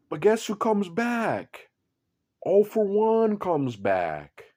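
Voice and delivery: slow, high announcer-style build; slow, high hype reveal; dramatic reveal. A narrator's reading. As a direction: draw out the big twist slowly.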